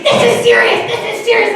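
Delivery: mock high-pitched urgent insistence. Friend insists during retold argument.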